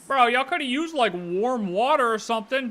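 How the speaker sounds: mock outrage. Real, not acted. Complaining about being soaked.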